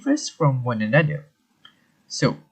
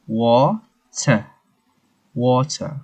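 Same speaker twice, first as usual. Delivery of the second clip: British water, crisp separated T and R-less ending; UK accent; much slower than usual. Shown as a demonstration. Teacher showing how UK water goes, British accent. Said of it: "the U K".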